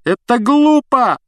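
high, loud and slow yell; shouting. A narrator's reading. Harry shouts at Snape to make him listen. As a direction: hurl it at him, desperate. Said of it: "закричал Гарри".

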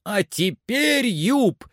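high-pitched call, beside himself; frantic excited cry. A narrator's reading. Master shouts up to his climber, wildly excited cry. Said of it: "закричал Легран вне себя от волнения".

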